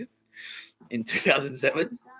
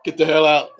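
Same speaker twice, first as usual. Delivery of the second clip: lightly acted plumber building to a loud dismissal; Simpsons plumber bit; 2 semitones higher, 9 dB louder than usual. Retelling the plumber thing from The Simpsons, mock outburst. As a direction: act out the cartoon bit, louder towards the end. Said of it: "like the plumber thing from The Simpsons".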